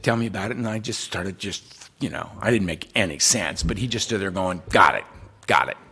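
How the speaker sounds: soft, hushed kindness; calm him down tenderly; gentle, low sponsor. Retold or imitated. Sponsor quietly leading a distraught man away. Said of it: "He said".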